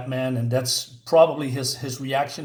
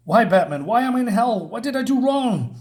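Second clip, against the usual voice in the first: higher, louder, anguished questions; hurt boy Robin; 8 semitones higher, 4 dB louder than usual. Acted. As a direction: cry out to Batman like a betrayed kid. Robin's ghost asks Batman why.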